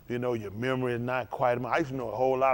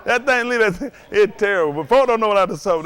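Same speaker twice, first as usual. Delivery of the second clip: laughing; 9 semitones higher, 10 dB louder than usual. Real, not acted. Laughing after the caller's reply.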